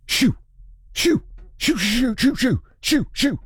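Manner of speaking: high, quick, repeated shoos; sharp little shoos, falling in pitch; shooing away. Acted. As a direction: shoo them off in short high-pitched bursts. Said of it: "I think this word translates in every language".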